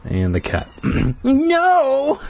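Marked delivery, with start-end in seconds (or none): 1.2-2.2 high despairing wail; long wail. A narrator's reading. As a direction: let out a long anguished wail. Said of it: "I let out a long wail".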